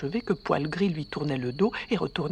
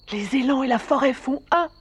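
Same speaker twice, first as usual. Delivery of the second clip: raised, higher-pitched exclamation; narrated cry; 7 semitones higher, 4 dB louder than usual. A narrator's reading. Elk crying out his bond with the forest, raised cry. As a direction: cry it out, lifted but short of a full shout. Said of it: "cria-t-il".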